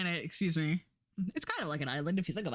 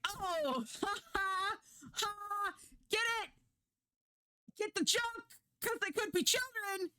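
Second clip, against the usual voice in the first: sarcastic mockery; 13 semitones higher, 3 dB quieter than usual. Mocking the show's joke.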